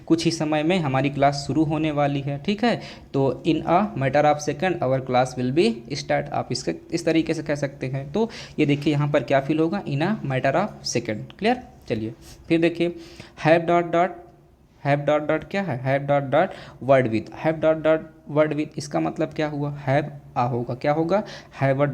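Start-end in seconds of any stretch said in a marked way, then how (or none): 3.5-6.3 slow, deliberate example sentence; slow classroom dictation; slow dictation pace. Shown as a demonstration. 9.8-11.4 each word drawn out slowly; slow classroom dictation; slow dictation pace. Shown as a demonstration. Teacher repeating the idiom slowly. 15.8-18.8 very slow, word by word; slow classroom dictation; slow dictation pace. Shown as a demonstration. Teacher reading the blank slowly.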